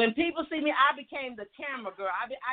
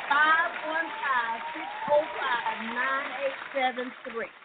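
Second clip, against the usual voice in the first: slow, stretched-out digits; deliberate number read-out; announcing a phone number; slower than usual. Real, not acted. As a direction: read each digit out slowly for callers. Reading out the call-in number.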